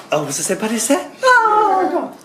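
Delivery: high-pitched put-on realisation; mock surprise. Answering people who ask about Saint Paddy's Day, mock surprise. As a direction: act amazed, voice shooting up on the oh. Acted forgetful reply, exaggerated surprise.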